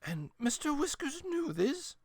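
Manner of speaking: put-on high, slow incredulous old lady; a grandmother piecing it together. A narrator's reading. Grandmother questions.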